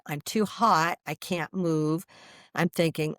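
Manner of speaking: normal talking voice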